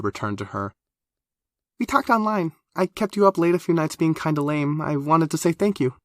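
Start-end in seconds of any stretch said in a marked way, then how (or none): 1.8-5.9 put-on high, quick, chatty young woman; eager high girl voice. A narrator's reading.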